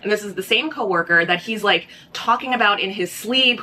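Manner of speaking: ordinary delivery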